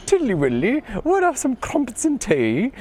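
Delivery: high, exaggerated Cockney London accent; mock Cockney accent. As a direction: squeak it out like a cartoon Londoner sipping tea. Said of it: "very Cockney London accent".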